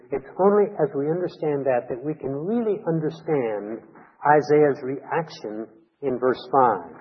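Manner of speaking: normal talking voice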